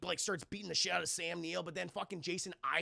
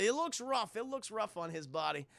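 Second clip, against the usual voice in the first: normal talking voice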